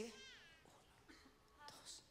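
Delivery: husband whispers to calm his excited wife; hushing whisper. Retold or imitated. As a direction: shush her gently, barely a breath. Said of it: "Entonces él me hace así".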